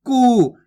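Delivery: "कु" with a long, forceful oo; stressed long vowel. Shown as a demonstration. Teacher reading the big oo sign, "कु" long and stressed. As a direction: push and stretch the vowel of "कु". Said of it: "यहाँ पे थोड़ा जोर लगाएंगे".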